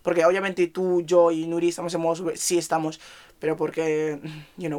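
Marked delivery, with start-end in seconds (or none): none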